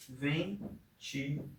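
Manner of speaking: "Ventilador" very slow and soft, split ven-ti-la-dor; syllable by syllable. Shown as a demonstration. Teacher counting four syllables for a pupil, "Ventilador" syllable by syllable. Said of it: "Quatro sílabas".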